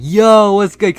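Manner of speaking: loud, high, delighted yo; happy greeting. Retold or imitated. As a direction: re-enact hailing a best friend, booming with excitement. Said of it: "I was really happy to see him".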